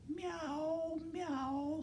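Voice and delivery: very high, thin meow; cat meowing. A narrator's reading. Reader to children as the cat, high meowing. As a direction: mew in a tiny high cat voice. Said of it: "said the cat".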